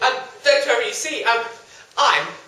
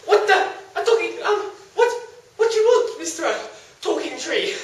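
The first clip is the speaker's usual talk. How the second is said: much higher, surprised and loud; startled high voice; 9 semitones higher, 4 dB louder than usual. Acted. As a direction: react to a talking tree. Young man startled by tree.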